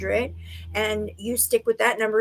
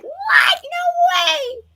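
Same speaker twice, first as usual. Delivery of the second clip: much higher, louder shocked cry; stunned disbelief; 5 dB louder, much slower than usual. Retold or imitated. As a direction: yelp it like you just won a million. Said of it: "I would be like".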